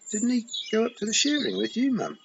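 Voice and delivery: put-on a girl sensing something is wrong; Tiffany nervously asks her frightened mother; suddenly nervous. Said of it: "said Tiffany, suddenly nervous".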